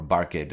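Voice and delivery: "barked" as two syllables, bark-id; mispronounced "barked" as bar-kid; wrong extra syllable. Shown as a demonstration. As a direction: sound out the E-D in "barked". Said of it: "Please don't say barged or barked".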